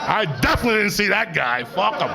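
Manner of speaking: high, laughing, stress on definitely; laughing repeat. Acted. Stoned driver laughing off the near-miss, laughing. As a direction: chuckle through definitely.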